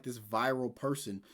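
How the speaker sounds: ordinary talk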